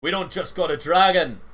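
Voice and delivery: loud gruff alarm; captain's loud exclaim. Acted. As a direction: bark the news to the crew. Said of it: "is like".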